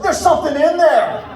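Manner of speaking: high, loud panicked shriek; a startled wife yelling in alarm; wife freaking out. Retold or imitated. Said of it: "She was like".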